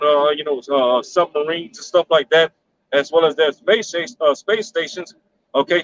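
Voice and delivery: usual speaking voice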